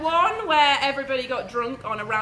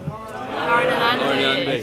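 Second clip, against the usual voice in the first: toast call; 7 dB louder, much slower than usual. Real, not acted. Toast echoed by guests.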